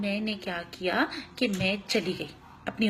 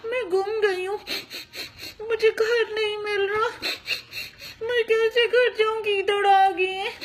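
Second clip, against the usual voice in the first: put-on high, tearful pleading of a lost toddler; crying child; 9 semitones higher than usual. To her kids, as the lost girl telling the uncle, tearful high plea. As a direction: sob it out like a small girl. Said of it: "ऐसे रो रही थी मैं".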